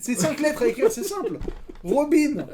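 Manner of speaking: high-pitched insistent plea; high, exasperated begging; pleading exasperation. Real, not acted. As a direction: beg as if it were the simplest thing. Pleads with partner to write one word.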